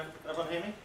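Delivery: ordinary talk, nothing marked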